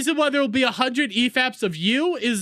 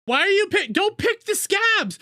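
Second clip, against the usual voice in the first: yelled protest; 12 semitones higher than usual. Real, not acted. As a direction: cry out in disgusted disbelief. Shouting in disbelief at scab talk.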